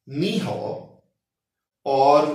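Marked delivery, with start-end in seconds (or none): 0.1-0.6 नी हॉ said as one Chinese greeting; नी हॉ pronounced as a Mandarin word; Mandarin hello. Shown as a demonstration. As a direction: join नी हॉ into a single word. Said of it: "मिलके एक word generate करते हैं".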